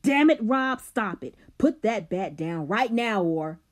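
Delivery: loud, high yell of pain and anger. Acted. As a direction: yell it while falling. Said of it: "yelling as he fell".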